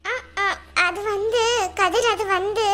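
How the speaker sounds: slow, halting, searching for words; hesitant stammering. Acted. Student dodging a question about unpaid fees, hesitant. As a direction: stall awkwardly, trailing upward.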